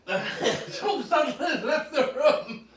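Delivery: slow, laughing through the words. Real, not acted. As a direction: giggle out the line. Laughing as Tom leaves.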